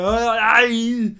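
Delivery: loud wordless action-hero bellow; Schwarzenegger. Acted. Action star reacts to the translator, hammed-up bellow. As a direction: bellow it in a mock Austrian accent. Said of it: "You do a real good Schwarzenegger".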